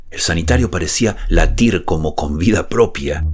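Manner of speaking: ordinary delivery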